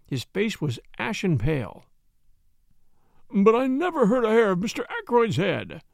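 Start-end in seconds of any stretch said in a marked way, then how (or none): 3.3-5.8 louder, higher pleading moan; plead, half-moaning. A narrator's reading. Frightened butler to the detective, moaning. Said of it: "he moaned".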